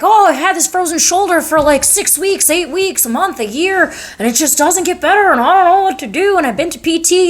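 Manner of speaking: high-pitched mock complaint of a patient; complaining patient. Patient listing months of failed shoulder treatment, mock complaining. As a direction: imitate a fed-up patient, pitched well up.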